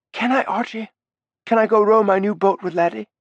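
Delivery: put-on boy begging to take the boat out; eager child. Alexei asks Archie to row, eager child voice.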